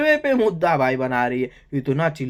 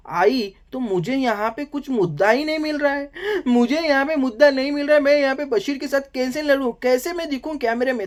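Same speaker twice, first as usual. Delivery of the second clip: mock Nehal thinking; 7 semitones higher than usual. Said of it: "इसको लगा भाई मुद्दा कहाँ से लाऊं मैं?".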